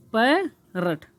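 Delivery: पैरट broken in two, "pai ... rat"; split pronunciation. Shown as a demonstration. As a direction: say पैरट syllable by syllable with a gap. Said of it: "इसको silent में रख देते हैं". (पैरट को तोड़कर, "पै ... रट")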